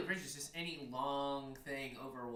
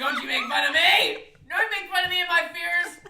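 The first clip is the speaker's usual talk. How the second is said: high laughing shout, mock outraged; laughing yell; 16 dB louder than usual. Real, not acted.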